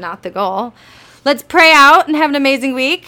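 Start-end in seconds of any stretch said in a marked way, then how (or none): 1.2-3.1 excited. Real, not acted. Cheerful sign-off.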